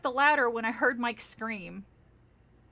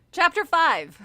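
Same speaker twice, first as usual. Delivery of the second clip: loud, bold announcer read; loud announcement; 4 semitones higher, 8 dB louder, slower than usual. Shown as a demonstration. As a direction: announce the chapter proudly.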